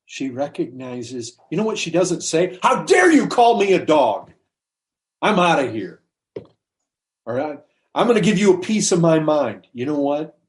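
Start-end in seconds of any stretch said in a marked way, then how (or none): 2.6-6.0 loud, high, offended retort; mock outrage at an insult; indignant outburst. Woman refuses being called a dog, indignant. 7.2-9.7 indignant threat. Retold or imitated. Woman threatens a piece of her mind, indignant.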